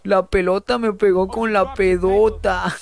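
repeating Kiko's line through laughter; laughing quote. Retold or imitated. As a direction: giggle while mocking the line. (citando a Kiko entre risas)

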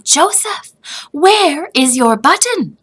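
a mother noticing something missing; alarmed mother. A narrator's reading. Mother asks Joseph where his button went.

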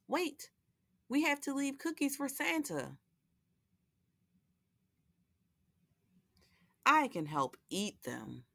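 lively picture-book read-aloud with character voices; reading to children. A narrator's reading. Host reading Lola the Elf to young viewers, storytelling voice. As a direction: read it like a bedtime story for little ones.

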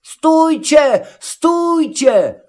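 very high, drawn-out plea; high goat cry to stop; Matołek calling out. A narrator's reading. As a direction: call after them at a high pitch. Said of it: "kozioł woła".

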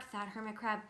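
ordinary delivery

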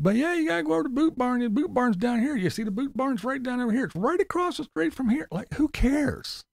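mocking the vlogger's Boot Barn tips; mocking Tim. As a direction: rattle off directions in a silly high voice. Mock Boot Barn directions.

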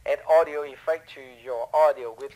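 tinny telephone sound; like talking through a phone line. Shown as a demonstration. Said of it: "So this is phone".

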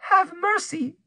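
very high, imploring cry; pleading cry. A narrator's reading. Kneeling Rose begs as her affair is exposed, pleading. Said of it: "cried Rose".

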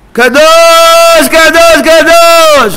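very high, drawn-out holy cry; crying out in prayer. Shown as a demonstration. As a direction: shout each word long and high. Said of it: "הוא צועק". (זעקה גבוהה וממושכת)

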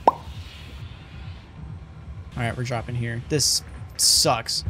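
ordinary talk

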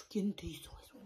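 hushed accusing whisper; mock jealous whisper. Acted jealous question.

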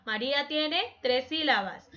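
ordinary delivery

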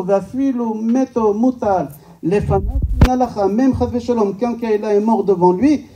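ordinary talk, nothing marked

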